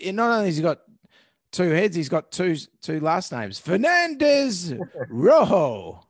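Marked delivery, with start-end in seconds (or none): 3.6-6.0 slow, rolled Spanish-style name with a laugh; Spanish accent. Pretend. Hamming up Spanish surname.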